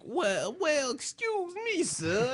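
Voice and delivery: hammed-up courteous pitch; polite salesman. Plays salad inventor addressing restaurateur.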